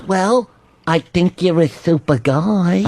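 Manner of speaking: slow, humoring agent imitation; Dennis. Agent humoring client who claims he's Superman, slow imitation. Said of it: "and he goes".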